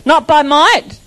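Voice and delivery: slow, emphatic scripture declaration; preaching. Acted. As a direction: proclaim it slowly with full force.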